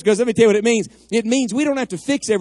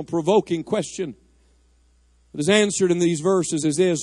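ordinary delivery

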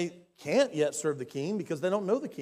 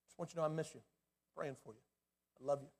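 soft texted message; 5 semitones lower, 9 dB quieter, faster than usual. Acted. Texting someone who drifted away.

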